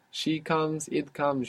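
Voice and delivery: usual speaking voice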